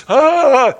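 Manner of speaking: sudden high startled cry; mock startled yelp; startled gasp. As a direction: jolt awake with a yelp. Startled wake in retold scene.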